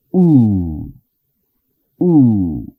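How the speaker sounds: U held long like a stretched Spanish u; long English u demo; long u vowel. Shown as a demonstration. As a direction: draw the U out longer. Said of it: "pero un poco más larga".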